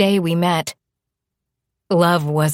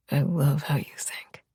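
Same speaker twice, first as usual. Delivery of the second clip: husky whisper; 2 semitones lower, 6 dB quieter than usual. Acted. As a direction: murmur it close to the ear. Lover's whispered reply.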